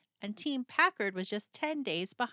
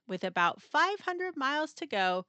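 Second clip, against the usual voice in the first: ordinary delivery, nothing marked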